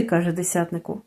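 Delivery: ordinary delivery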